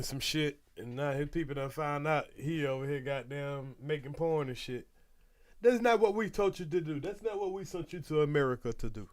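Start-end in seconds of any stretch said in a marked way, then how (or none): 5.6-9.1 put-on scolding in a rough African accent. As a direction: play a disappointed African parent, African accent. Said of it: "My African accent suck".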